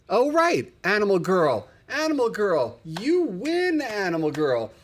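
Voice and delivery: high, loud shout-out to a fan; excited shout-out. Real, not acted. Host cheering a viewer who remembered the episode, high and loud. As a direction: call the fan's name like she won a prize.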